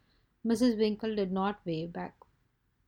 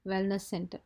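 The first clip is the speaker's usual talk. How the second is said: ordinary delivery, nothing marked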